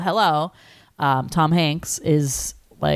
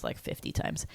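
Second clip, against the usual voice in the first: whisper; 15 dB quieter than usual. Real, not acted. Unvoiced aside.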